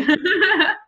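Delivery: a short, high burst of real laughter. Real, not acted. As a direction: laugh out loud, amused. Laughs after the conclusion.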